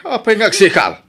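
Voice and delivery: startled shout of worry; alarmed. Acted. Husband hears his wife is in labour, alarmed shout.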